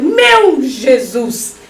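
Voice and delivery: drawn-out theatrical plea to heaven; hammed-up desperate invocation; mock crying out. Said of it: "você levanta os braços e grita".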